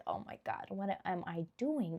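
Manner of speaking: breathy, frightened whisper to herself; panicked whisper. Pretend. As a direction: whisper it like you've caught yourself doing something strange. Panicked self-doubt imagined.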